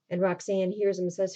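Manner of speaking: ordinary talk, nothing marked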